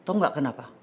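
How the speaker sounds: plain reading voice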